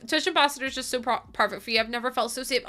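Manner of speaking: normal talking voice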